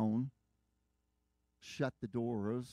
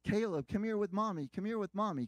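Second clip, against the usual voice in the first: put-on high, louder urgent call to a child; mother calling out; 10 semitones higher, 5 dB louder than usual. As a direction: call the boy back to mommy, pitched up. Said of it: "My wife is saying".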